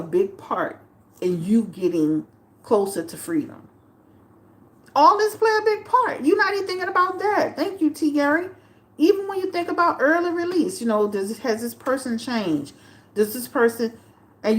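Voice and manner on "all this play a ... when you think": worked-up outburst. Real, not acted. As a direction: burst out, fed up with him. Worked-up rant at the viewers.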